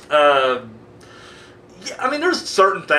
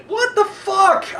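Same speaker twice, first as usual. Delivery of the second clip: bitter exasperation; 13 semitones higher, much slower than usual. Real, not acted. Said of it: "I'm getting bitter now".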